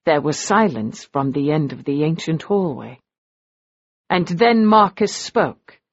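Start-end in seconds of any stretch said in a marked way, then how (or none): none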